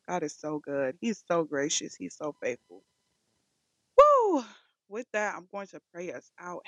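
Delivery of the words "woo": high, loud "woo"; short burst of praise, pitched way up; elated whoop. Real, not acted. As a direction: let out a sudden high whoop. Exclaims after praising God.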